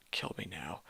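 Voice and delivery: a real weary whisper of despair; whispered aside. Real, not acted. Reader whispering her suffering between chapters. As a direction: whisper it, begging to be spared.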